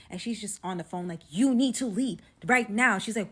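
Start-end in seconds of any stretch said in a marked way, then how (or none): 1.3-3.0 louder, slow and emphatic "You need to leave right now"; weight each word of "You need to leave right now"; urgent warning. Retold or imitated. Best friend on the phone, urgently stressing "You need to leave right now".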